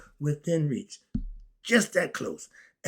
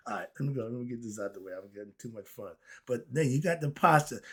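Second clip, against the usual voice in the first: ordinary talk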